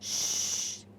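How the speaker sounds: soft whistling shh; a long hushed shh; hushing shh. Shown as a demonstration. Teacher to class, a soft shh. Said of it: "जैसे हम शीटी बजाते हैं ना".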